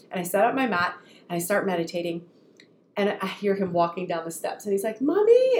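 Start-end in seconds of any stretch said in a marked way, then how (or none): 5.0-5.6 put-on high, loud child's call up the stairs; high little boy's call; child calling Mommy. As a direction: call out like a small boy.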